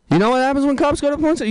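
comic asks the crowd again, excited and fast; excited repeat. Shown as a demonstration. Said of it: "repeats question".

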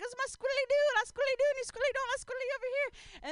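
silly squeaky voice. Retold or imitated. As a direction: squeal like a giddy tripping kid. Friend tripping, dancing with awning.

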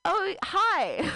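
light impression of a surprised guy; mimicking him. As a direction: play the casual guy. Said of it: "he goes".

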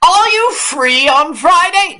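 butter Harvey up in a high sweet voice; fawning director. Retold or imitated.

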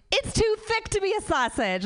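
high-pitched mock husband protest; exasperated husband. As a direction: squeal the objection as the arguing husband. Acted husband arguing about sausage.

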